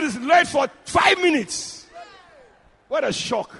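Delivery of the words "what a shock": ordinary talk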